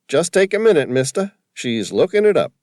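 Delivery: high, loud broad East Coast cabbie voice. A narrator's reading. Cabbie calling to Sam from the taxi, broad East Coast accent. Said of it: "his fake accent".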